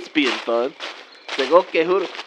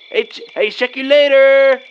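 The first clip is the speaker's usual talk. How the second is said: louder, higher, slowed laid-back sign-off; cool goodbye; 5 semitones higher, 5 dB louder than usual. Acted. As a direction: drag out later like a movie cool guy. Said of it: "that's like off the scene off of Days Confused".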